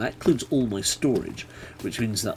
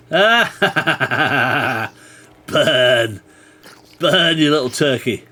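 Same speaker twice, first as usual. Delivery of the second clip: gloating; 4 semitones higher, 8 dB louder, much slower than usual. Real, not acted. Gloating as the mob burns.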